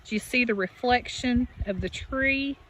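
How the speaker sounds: ordinary talk, nothing marked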